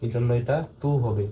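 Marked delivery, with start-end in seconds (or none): 0.8-0.9 তু with a long held oo; drawn-out vowel. Shown as a demonstration. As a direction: stretch the vowel of তু for one alif. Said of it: "অর্থাৎ এক আলিফ টান হবে". (তু-এর উ এক আলিফ টেনে)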